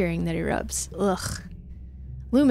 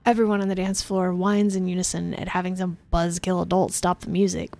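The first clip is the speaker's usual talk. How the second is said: ordinary delivery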